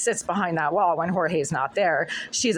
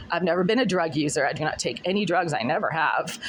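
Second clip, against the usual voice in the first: ordinary talk, nothing marked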